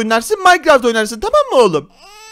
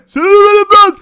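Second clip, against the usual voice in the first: loud playful threat; half-joking loud threat; mock threat; 6 semitones higher, 7 dB louder than usual. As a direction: shout a teasing threat.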